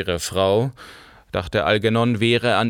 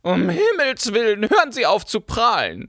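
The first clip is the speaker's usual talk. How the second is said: put-on much higher old baroness snapping in; cutting in sharply; 12 semitones higher than usual. A narrator's reading. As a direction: interrupt with contempt. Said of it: "fuhr die Baronin dazwischen". (scharf dazwischenfahrend)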